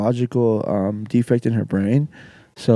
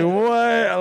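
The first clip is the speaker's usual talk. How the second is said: a high, startled "What?"; jolted, half-laughing disbelief; mock shock; 9 semitones higher than usual. Retold shock at the massage.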